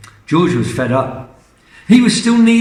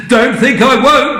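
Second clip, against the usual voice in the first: high, loud defiance; defiant threat; 9 semitones higher, 6 dB louder than usual. Acted. Grandma threatening to drink it, loud defiant. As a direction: dare them to stop her. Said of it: "Grandma said".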